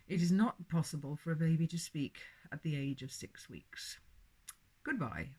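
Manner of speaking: doctor scolding the tired parents, loud and firm; stern doctor. Acted. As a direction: tell them off like a brisk doctor.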